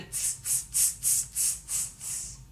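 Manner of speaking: soft voiceless monkey "tss" noises; monkey chatter. Acted. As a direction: hiss short tss sounds like mimicking monkeys. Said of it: "monkeys only shook their fingers back at him and said".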